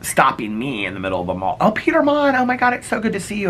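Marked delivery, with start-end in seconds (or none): 1.6-3.5 high, gushing mock squeal of delight; gushing popular classmate. Popular classmate stopping him in a mall, high mock gushing. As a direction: overdo the fake delight, pitched way up.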